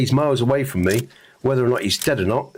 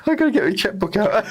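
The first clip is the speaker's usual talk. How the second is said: laughing; 7 semitones higher than usual. Real, not acted. Laughing at own joke.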